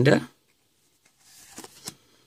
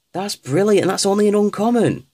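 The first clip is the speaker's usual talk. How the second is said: ordinary talk, nothing marked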